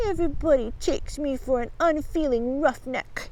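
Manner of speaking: soft, put-on wounded bully; sighing. A narrator's reading. Bugs plays the misunderstood nature lover, sighing. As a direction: sigh it with mock hurt. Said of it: "he sighed".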